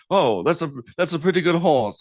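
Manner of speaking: hammed-up fake English gentleman accent; bad British accent. Film fan mimicking the actor's bad British accent. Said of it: "not a good British accent".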